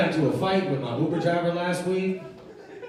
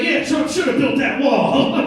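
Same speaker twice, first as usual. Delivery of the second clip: high, loud, drawn-out backpedalling; suddenly side with the bully, much higher pitched; mock eager agreement; 9 semitones higher, 7 dB louder than usual. Regretful passenger to the big angry man, over-eager agreement.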